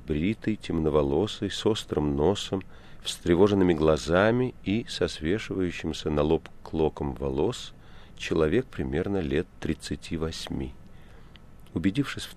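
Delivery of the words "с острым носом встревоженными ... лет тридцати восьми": ordinary delivery, nothing marked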